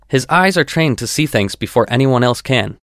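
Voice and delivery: usual speaking voice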